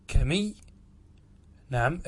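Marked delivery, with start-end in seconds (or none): 0.1-0.5 كمي with one short m; undoubled mim. Shown as a demonstration. Teacher repeating the undoubled option for students, كمي with single m. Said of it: "ترك التضعيف".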